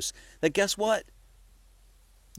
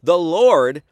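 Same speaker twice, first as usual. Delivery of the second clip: "Lord" punched very loud; stress on capitals; 10 dB louder than usual. Shown as a demonstration. Showing listeners the verse's capitalization, loud stress on "Lord". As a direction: hit "Lord" hard, as if printed in capitals. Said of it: "look at the capitalization".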